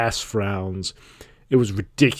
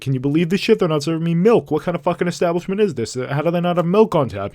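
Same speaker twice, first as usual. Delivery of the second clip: higher-pitched mock outrage; indignant complaining; 6 semitones higher than usual. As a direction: rant in disbelief to anyone around. Retold complaint to people at bar.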